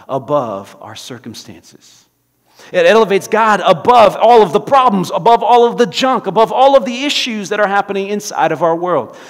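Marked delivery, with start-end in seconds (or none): 2.7-9.2 loud, high preaching build; preaching at full force. Acted. Preacher rousing the congregation, loud and high. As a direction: lift God above it all, full voice.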